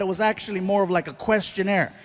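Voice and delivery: ordinary delivery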